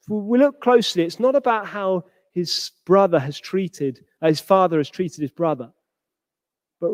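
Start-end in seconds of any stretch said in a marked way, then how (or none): none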